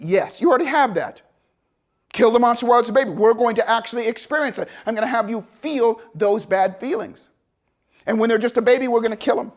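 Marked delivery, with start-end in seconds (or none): none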